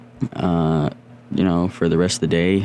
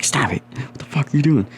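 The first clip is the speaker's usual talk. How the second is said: sister's exasperated scolding; 9 semitones higher than usual. Retold or imitated. Sister scolds him eating snow.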